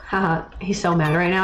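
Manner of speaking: usual speaking voice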